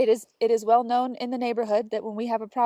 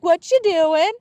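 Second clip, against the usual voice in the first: higher and louder, chipper call-out; cheerful neighbour greeting; 7 semitones higher, 10 dB louder, slower than usual. Retold or imitated. Quoting Greg greeting her over the fence, cheerful. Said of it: "he's like".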